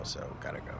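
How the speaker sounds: soft, breathy, unvoiced so gotta go; whispered goodbye. Real, not acted. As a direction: whisper it to yourself. Quiet repeat of goodbye.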